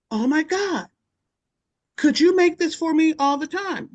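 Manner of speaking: put-on delighted old lady. Audrey asking Tony to make it always: high delighted plea. Audrey begs for more oatmeal.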